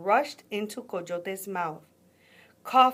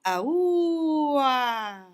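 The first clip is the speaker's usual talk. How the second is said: long high loud howl at the moon; drawn-out wailing coyote howl; 6 semitones higher, 6 dB louder than usual. A narrator's reading. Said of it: "and howled".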